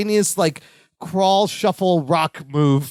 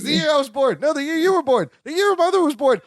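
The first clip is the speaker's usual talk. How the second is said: rising panic, higher with each guess; panicking; 9 semitones higher than usual. Retold or imitated. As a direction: climb into panic as the guesses fail. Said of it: "he's panicking".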